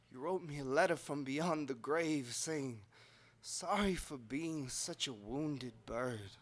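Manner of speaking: very soft, low and slowing; hushed letter reading. Acted. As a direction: read the letter gently, almost under the breath. Reads dead mother's letter.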